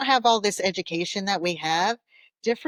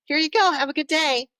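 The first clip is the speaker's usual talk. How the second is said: fake cheery sendoff; 8 semitones higher, 5 dB louder, faster than usual. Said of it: "you're gonna be like".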